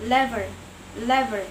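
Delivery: lever repeated loudly in American. Shown as a demonstration. Student echoing the recording, American lever. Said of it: "eh in lever".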